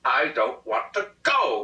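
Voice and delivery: lento, mais alto, firme; grito da esposa. A narrator's reading. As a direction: esposa gritando que não quer ir. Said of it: "shouted Missus Preble".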